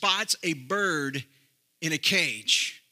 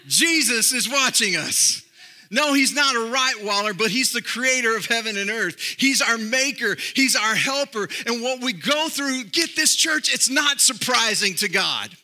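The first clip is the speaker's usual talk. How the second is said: higher and louder, rising pulpit refrain; full-force preaching; 6 semitones higher, 4 dB louder than usual. Acted. Preacher assuring the church God watches, full-force preaching. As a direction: hammer each "He sees" home to the church.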